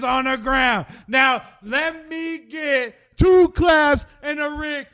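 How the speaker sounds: strut it out like Ric Flair; Ric Flair impression. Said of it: "go into straight ass Ric Flair".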